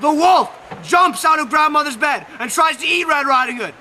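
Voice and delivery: loud, high dramatic narration; excited shout. A narrator's reading. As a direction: shout the big moment. Climax of the wolf attack.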